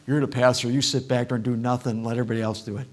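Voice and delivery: low, sneering jab at the pastor; mock accuser. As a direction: imitate someone needling him, mildly mocking. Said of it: "did somebody go".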